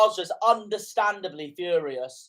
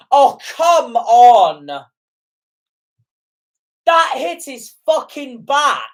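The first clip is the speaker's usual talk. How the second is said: outraged shout at the referee's call, real anger; furious protest; 7 semitones higher, 10 dB louder, slower than usual. Real, not acted. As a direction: yell it like a robbed fan. Fan furious at penalty decision.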